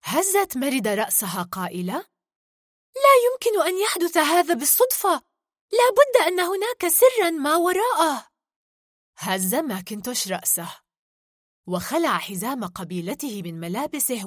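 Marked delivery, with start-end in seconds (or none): 2.9-8.3 put-on high, emphatic storybook girl voice; intrigued heroine. Merida suspects a secret behind the light, intrigued. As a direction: insist on it in a raised, louder character voice.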